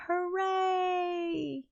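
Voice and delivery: high but soft, limp little hooray; deflated hooray. Retold or imitated. Streamer tells the characters to stop being angry, then cheers limply. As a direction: let the cheer fizzle out. Mock cheer after calming everyone.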